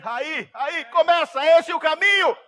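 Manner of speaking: high, loud urging from a pastor; excited approval. Acted. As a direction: cheer the young man on, acted. Pastor urges on the praying youth. (aprovação empolgada, gritada)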